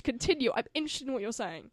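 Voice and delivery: ordinary delivery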